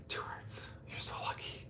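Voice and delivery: hushed excited whisper. Real, not acted. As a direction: whisper it to the dog. Telling dog about hearts.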